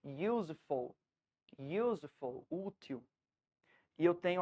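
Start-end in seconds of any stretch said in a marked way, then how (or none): 0.0-2.5 "useful" with the first syllable drawn out; "useful", weight on "use", lower and unhurried; stressed first syllable. Shown as a demonstration. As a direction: say "useful" slowly, leaning on the start, falling after. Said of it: "a sílaba tônica é aquela que vibra mais".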